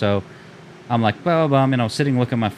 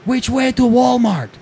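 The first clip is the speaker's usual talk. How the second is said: much higher, louder, slow ditzy question; airheaded driver impression; 13 semitones higher, 7 dB louder, slower than usual. Rear-ending driver asking directions, high slow ditzy voice. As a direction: play her clueless and cheerful, drawn out slow. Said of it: "And she was like".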